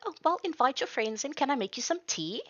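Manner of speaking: lightly voiced granny offering tea; eager hostess. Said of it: "she's like".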